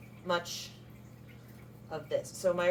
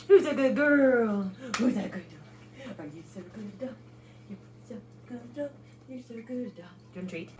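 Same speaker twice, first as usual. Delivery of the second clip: pet talk; 3 semitones higher than usual. Real, not acted. Sweet talk to the dog.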